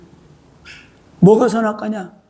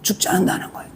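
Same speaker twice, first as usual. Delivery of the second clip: hushed insistence; 3 semitones lower, 9 dB quieter, faster than usual. Acted. Satan whispering to Eve she won't die. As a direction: drop to a breathy whisper. Satan's claim voiced softly.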